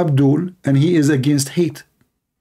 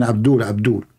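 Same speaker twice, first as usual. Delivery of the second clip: Trump impression; 5 semitones lower than usual. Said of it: "He keeps saying".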